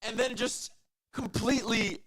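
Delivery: high-pitched, hyped caster call; thrilled play-by-play. Real, not acted. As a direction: call the replay still buzzing, pitched well up. Play-by-play right after the screams.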